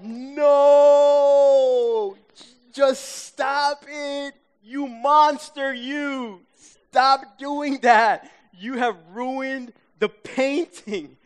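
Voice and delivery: high, loud, drawn-out outraged cry; hammed-up screaming at the TV, slow and high; angry retold scream. Viewer retelling his protest at the TV painter, angry scream. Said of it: "I am screaming angrily".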